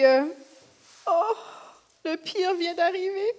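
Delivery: subdued, halting voice with long pauses; grieving mother Mary. Acted. Mary telling everyone she no longer has her son, grieving. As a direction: speak softly through sorrow, faltering.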